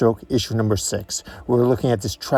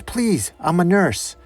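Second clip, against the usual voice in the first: higher-pitched pleading woman; nervous plea; 7 semitones higher, slower than usual. Acted. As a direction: plead gently but urgently to help. Frightened nurse pleads with gunman.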